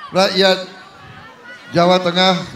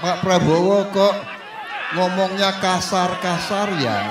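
mock Jakarta elite; 4 semitones lower, 4 dB quieter than usual. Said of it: "sering ditegur oleh elit-elit Jakarta".

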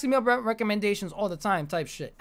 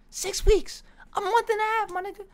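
much higher, disbelieving cry over wasted weeks; exasperated squeal; 14 semitones higher than usual. Real, not acted. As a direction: cry out at the lost time.

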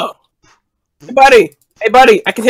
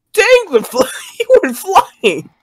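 excited high voice; 9 semitones higher, slower than usual. Real, not acted. Character flung across screen.

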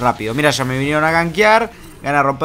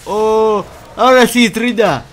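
higher, louder sudden thrill; excited outburst; 7 semitones higher, 6 dB louder, slower than usual. Real, not acted. Player thrilled as the fight finally starts, excited and loud. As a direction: burst out as the fight finally comes.

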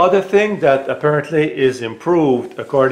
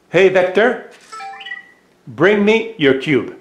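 raised, higher command, slowing on the last words; calling out; 6 semitones higher than usual. Real, not acted. Voice command to the robot.

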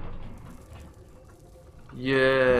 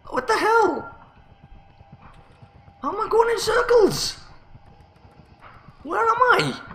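much higher, squeaky mock-panicked voice. Player lost in circles squeaks to himself in mock panic. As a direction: squeal the questions like a frantic cartoon.

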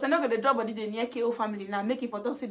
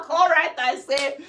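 ordinary talk

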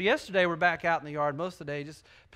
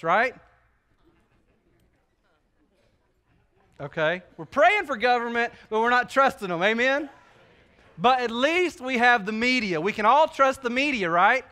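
louder, higher, ironic preaching; sarcastic preaching; 4 semitones higher, 5 dB louder than usual. Acted. Preacher teasing the congregation about trusting government, loud and ironic. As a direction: work the crowd with mock trust.

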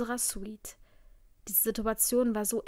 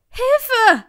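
very high, loud scream for help; full-throated scream; 13 semitones higher, 15 dB louder than usual. A narrator's reading. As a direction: scream for help at the top of your lungs. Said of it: "schrie ich aus voller Kehle". (gellender Hilfeschrei aus voller Kehle)

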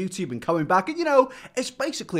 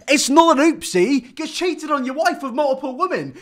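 outraged; 6 semitones higher, 5 dB louder than usual. Real, not acted. Outraged at the word.

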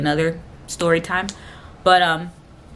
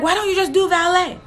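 high-pitched mock yelling; retold yell; 12 semitones higher, 3 dB louder than usual. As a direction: imitate an exasperated mom yelling. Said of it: "There's no need to yell".

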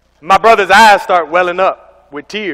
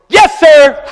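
eager yes sir; 11 semitones higher, 7 dB louder than usual. Retold or imitated. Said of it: "looks at me and says".

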